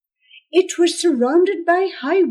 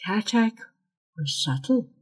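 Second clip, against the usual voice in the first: ordinary delivery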